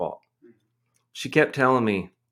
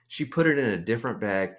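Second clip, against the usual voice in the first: normal talking voice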